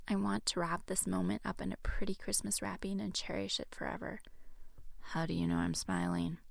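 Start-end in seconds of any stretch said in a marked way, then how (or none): 5.0-6.4 low, sultry male voice. A narrator's reading. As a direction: purr it low and seductive. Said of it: "Jaden asks in a sultry voice".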